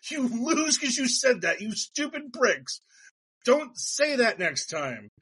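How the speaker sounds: hammed-up frustrated ruling; exasperated judge. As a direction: scold them like a fed-up judge. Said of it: "the judge was like".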